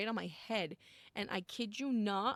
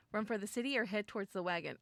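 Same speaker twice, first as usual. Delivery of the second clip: ordinary delivery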